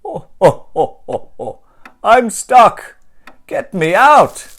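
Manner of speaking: high, very loud panicked cries; Santa shouting stuck. Acted. Santa stuck in the chimney, shouting loud for help. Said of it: "then turns to a shout".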